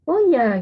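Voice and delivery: "Oh ya" swooping high, then falling; nada turun naik. Shown as a demonstration. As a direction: react with a lively rise and fall on "Oh ya". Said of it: "Ada turun naiknya". ("Oh ya" dengan nada naik lalu turun)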